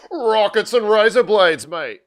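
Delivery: a high, loud put-on Australian accent. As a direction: play up the Aussie twang for the kids. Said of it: "I just started speaking in an Australian accent".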